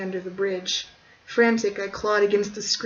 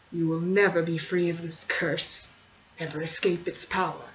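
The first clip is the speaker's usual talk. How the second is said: a hint of a menacing old count. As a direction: threaten her with the family curse. Count speaks, low, slow.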